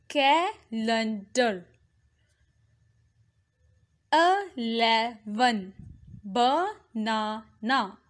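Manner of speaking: slow, each word split into three separate beats; teacher slowly dividing words into syllables. Shown as a demonstration. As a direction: sound out every syllable with gaps between. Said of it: "I am pronouncing it with the syllables".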